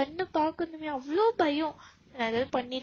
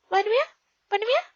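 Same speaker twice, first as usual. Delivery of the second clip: higher and louder, pushing for a yes; eager childish demand; 7 semitones higher, 6 dB louder than usual. Acted. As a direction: badger her like an excited kid. Husband demands promise eagerly. (உற்சாகமாக கேட்கும் கணவன்)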